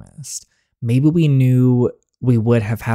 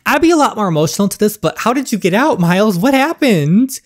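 higher and louder, fast rush of questions; excited surprise; 10 semitones higher, 5 dB louder than usual. Real, not acted. Player reacts to Miles getting out, excitedly higher and louder. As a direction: burst out with eager curiosity.

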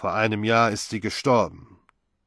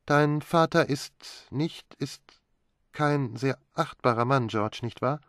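put-on slow, lightly higher young wife; Magdalene probing; 6 semitones higher than usual. A narrator's reading. Wife sounding out George about his father, slow and sly. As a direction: draw it out slyly.

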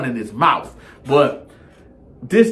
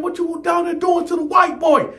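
shouting. Retold or imitated. Inmates yelling down the tier.

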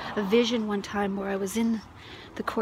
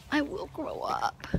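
softer unvoiced whisper of the promise; 5 semitones higher, 6 dB quieter, slower than usual. Shown as a demonstration. Jody meditating on the promise, in a whisper. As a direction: whisper it to yourself like a mantra.

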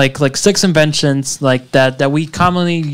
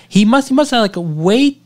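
excited; 8 semitones higher than usual. Real, not acted. Excited speculation.

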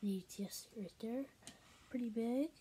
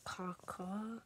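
lips not touching; 3 semitones lower than usual. Shown as a demonstration. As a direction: say it with lips apart. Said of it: "without touching your lips".

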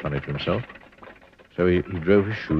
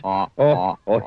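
plain reading voice

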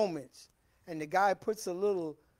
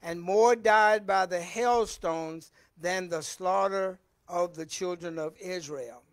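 loud, slow preaching read of the battle verses; forceful scripture reading; 3 semitones higher, 8 dB louder than usual. Acted. Preacher reading Joshua to his congregation, loud and proclaiming. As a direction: proclaim it to the church like thunder.

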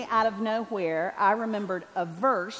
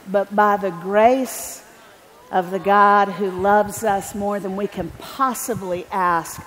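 building preaching; 6 dB louder than usual. Acted. Preaching at full force.

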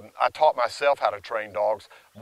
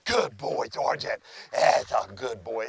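loving reward voice; 3 semitones lower than usual. Shown as a demonstration. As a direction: praise the dog sweetly, pitched up. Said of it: "practice your loving reward marker".

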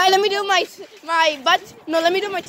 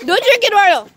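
urging; 5 semitones higher, 3 dB louder than usual. Real, not acted. Urging brother repeatedly.

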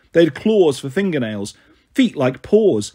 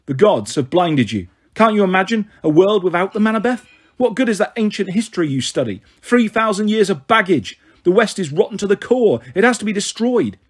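higher, urgent persuasive voice; impassioned villain; 4 semitones higher than usual. Acted. Luke urges Annabeth to join him, impassioned. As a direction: win her over with fervent conviction.

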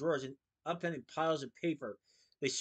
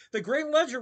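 high, loud triumphant cheer; 11 semitones higher, 10 dB louder than usual. A narrator's reading. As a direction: shout it out in triumph, much higher and louder. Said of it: "Willie cheered".

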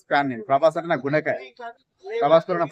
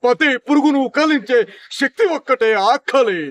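Sai Kumar dialogue; 5 semitones higher than usual. Acted. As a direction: thunder it out like a stage villain. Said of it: "Sai Kumar గారు dialogue".

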